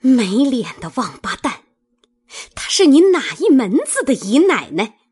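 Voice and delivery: angry, rising to a loud, high, quick question; spitting scolding. A narrator's reading. Mistress cursing the servant over the second wife, spitting angrily. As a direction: spit the insult out, then pounce on the question. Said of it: "使劲啐道".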